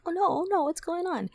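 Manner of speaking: high, frantic oh no; rising panic at the screams; panicked. Retold or imitated. Hears screaming around island.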